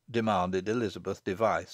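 ordinary delivery, nothing marked

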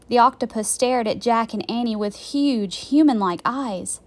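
plain reading voice

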